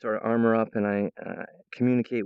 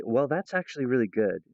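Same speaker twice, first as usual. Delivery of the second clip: ordinary talk, nothing marked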